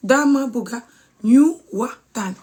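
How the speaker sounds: slowly, with a pause after bugga; slow demonstration. Shown as a demonstration. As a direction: break the phrase into slow, separate words for learners. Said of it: "I'll try and say it slowly".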